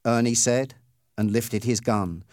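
ordinary delivery, nothing marked

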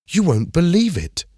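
thrilled at the find, pitch jumping up; excited. A narrator's reading. Emma finding the subscription list, excited. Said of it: "she said excitedly".

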